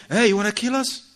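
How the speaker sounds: high sharp mock scold at the husband; wife mockingly snapping at her driving husband, mock scold; mock harsh scolding. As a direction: snap at him like a rude passenger. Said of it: "She's not gonna talk to him".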